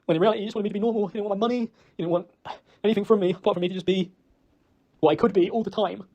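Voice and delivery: ordinary delivery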